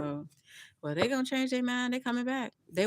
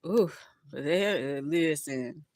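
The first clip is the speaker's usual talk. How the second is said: normal talking voice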